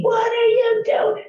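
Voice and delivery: high-pitched mock scream; retold screaming. Agent imitating an angry agent on the phone, high mock scream. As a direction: screech it in imitation of an irate caller.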